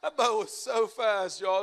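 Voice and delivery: loud, slow, amazed shout; excited shout. Real, not acted. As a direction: belt it out like you're still on the boat. Reliving the boat's speed.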